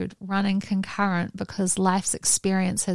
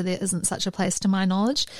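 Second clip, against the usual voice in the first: normal talking voice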